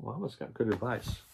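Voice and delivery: ordinary delivery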